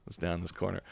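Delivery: ordinary talk, nothing marked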